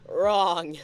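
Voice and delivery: loud, punchy one-word verdict; emphatic wrong. Real, not acted. Streamer mocking their own naive plan, loud verdict. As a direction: slam the word down as a joke punchline.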